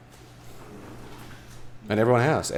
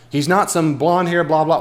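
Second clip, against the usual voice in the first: mock indignant; 8 semitones higher, 6 dB louder than usual. Mocking a Jesus-image comment.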